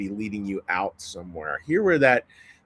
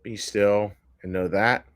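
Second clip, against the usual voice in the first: guided meditation; 3 dB quieter than usual. Acted. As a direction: let each line fall away into the silence. Leads a meditation.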